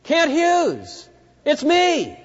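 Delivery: high, excited calling out to a passing float; shouting to a friend. Retold or imitated. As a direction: yell to get a cheerleader's attention. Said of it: "I started to shout".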